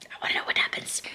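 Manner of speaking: fast, hushed and eager; a quick excited whisper; hurried whisper. Real, not acted. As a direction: whisper it, itching to get back to the book.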